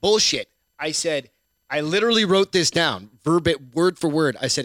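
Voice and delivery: ordinary talk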